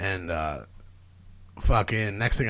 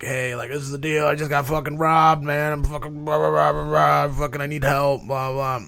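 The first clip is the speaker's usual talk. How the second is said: higher-pitched, babbled retold plea; pleading; 6 semitones higher than usual. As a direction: imitate yourself begging strangers for help. Retold plea to bar strangers.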